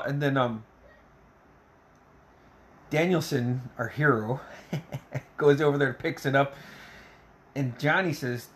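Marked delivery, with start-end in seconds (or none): none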